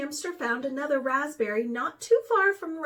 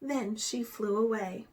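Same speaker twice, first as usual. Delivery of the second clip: ordinary delivery, nothing marked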